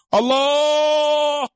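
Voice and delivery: high, drawn-out wail to God; mimicking a man breaking into tears; imitated weeping cry. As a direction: cry out the name long and high. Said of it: "Menangis dia".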